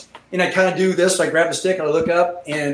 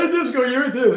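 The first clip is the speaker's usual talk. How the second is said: high-pitched excited mimicry; mock-excited babble; excited friend; 9 semitones higher than usual. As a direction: imitate an eager pilot buddy, pitched way up. Acting out excited friend's request.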